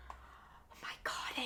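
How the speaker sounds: breathy whisper, slow; whispered aside. Real, not acted. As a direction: whisper it to yourself, slowly. Aside after drinking-game joke.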